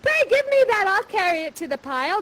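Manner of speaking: high, pleading maiden voice, lifted on oh and pray; Miranda's plea. Acted. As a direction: play Miranda begging him to rest. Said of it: "you come in and you say".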